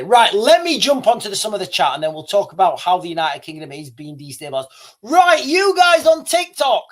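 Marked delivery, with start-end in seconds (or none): 5.0-6.8 calling out. Real, not acted. Calling out to TikTok viewers.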